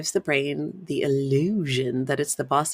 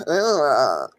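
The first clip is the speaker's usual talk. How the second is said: louder sighing ugh; disgusted sigh ugh; 7 dB louder than usual. Shown as a demonstration. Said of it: "be like".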